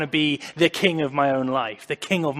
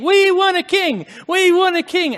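mock crowd chant, much higher and louder; crying-out crowd; 10 dB louder than usual. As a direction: voice the clamoring people. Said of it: "And God's saying".

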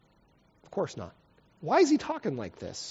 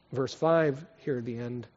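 normal talking voice

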